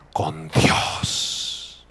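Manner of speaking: low near-whisper; hushed and low, letting it hang; reverent hush. Real, not acted. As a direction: drop to a soft, awed murmur. Reverent drop ending the climax.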